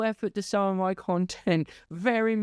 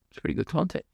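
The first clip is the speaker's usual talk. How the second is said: a hint of a lazy viewer; mock commenter; 7 semitones lower, much faster than usual. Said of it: "some people were like".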